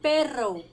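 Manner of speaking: perro with a rolled Spanish r, stress on the first syllable; Spanish pronunciation. Shown as a demonstration. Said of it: "cachorro em espanhol a gente fala". (perro com rr vibrante espanhol, tônica na primeira sílaba)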